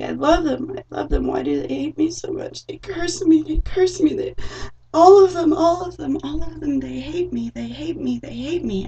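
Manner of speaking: high, loud wailing lament; grieving demon. Retold or imitated. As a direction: wail it like a heartbroken spirit. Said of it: "And he's like".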